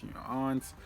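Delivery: ordinary delivery, nothing marked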